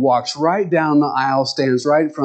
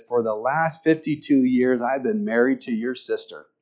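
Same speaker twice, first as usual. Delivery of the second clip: softer and lower, dry delivery; deadpan punchline; 3 semitones lower, 6 dB quieter than usual. Acted. Old man delivering the joke's punchline to Satan, dry and softer. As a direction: let the punchline drop quietly.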